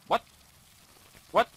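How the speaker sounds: usual speaking voice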